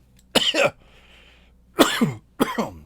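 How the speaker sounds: loud hacking cough; hard cough. Real, not acted. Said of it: "It's got me coughing real hard".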